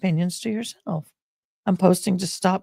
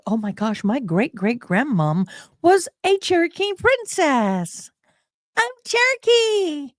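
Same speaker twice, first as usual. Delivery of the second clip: high, slow starstruck gush; gushing stranger; 9 semitones higher than usual. Retold or imitated. Imitating people who claim Cherokee princess ancestry, high gush. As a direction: mimic an eager fan of her heritage. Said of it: "they come up to me and go".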